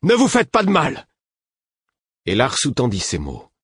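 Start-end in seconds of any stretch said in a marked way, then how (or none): 0.0-1.1 high, loud shout of alarm; horrified cry. A narrator's reading. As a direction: cry out to stop the fight. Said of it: "criai-je épouvanté". (cri épouvanté, aigu et fort)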